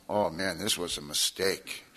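a quiet, slow aside of regret; nervous inner thought. Retold or imitated. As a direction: mumble it to yourself, uneasy. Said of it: "and I'm going".

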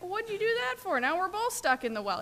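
what on earth did you do that for; incredulous. Retold or imitated. Stuck man to rescuer who jumped in, high incredulous squawk.

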